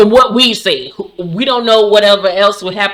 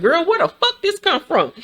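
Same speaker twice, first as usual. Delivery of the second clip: baffled; 10 semitones higher than usual. Retold or imitated. Her baffled reaction, high.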